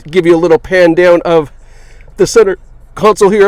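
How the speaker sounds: talking through hiccups; hiccuping between words. Real, not acted. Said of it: "I have the hiccups now".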